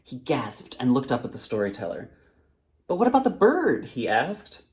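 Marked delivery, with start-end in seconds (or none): 2.9-3.9 put-on high, eager young boy. A narrator's reading. Thirsty boy begs the storyteller for more, high and eager. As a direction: ask it like an excited child.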